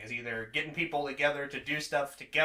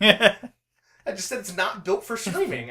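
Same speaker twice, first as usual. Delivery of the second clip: exasperated; 7 semitones higher, 9 dB louder, slower than usual. Real, not acted. Defending, exasperated.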